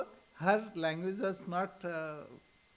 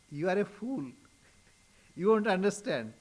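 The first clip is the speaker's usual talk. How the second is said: louder, amused scolding with a chuckle; mock rebuke; 2 semitones higher, 5 dB louder than usual. Swamiji answering Latu Maharaj's doubts, mock rebuke. Acted rebuke in retold dispute.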